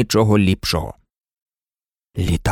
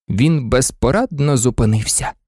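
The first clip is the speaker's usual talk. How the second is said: ordinary delivery, nothing marked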